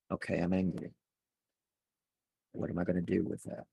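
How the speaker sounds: soft, low, calm inner voice; calm self-talk. Shown as a demonstration. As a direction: talk yourself down gently, like calming a friend. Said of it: "notice how my tone of voice just changed".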